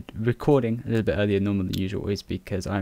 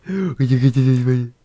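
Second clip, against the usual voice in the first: slow, louder gasped alarm; hammed-up slow scared gasp; mock gasp; 3 semitones higher, 6 dB louder, slower than usual. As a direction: gasp then draw it out in mock terror. Repeats in alarm.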